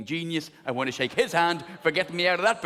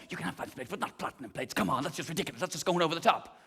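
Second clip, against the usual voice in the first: quiet scolding; 6 dB quieter, faster than usual. Retold or imitated. Brain voice hushing him over plates, softly scolding. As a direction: hush him like a sensible parent.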